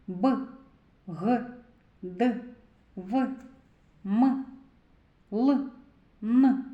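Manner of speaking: "Б, Г, Д, В, М, Л, Н" as short separate sounds; isolated consonant sounds. Shown as a demonstration. Teacher has children repeat after her, "Б, Г, Д, В, М, Л, Н" as brief single sounds. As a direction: say "Б, Г, Д, В, М, Л, Н" one by one, each cut short with a pause after. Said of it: "Повторюйте за мною".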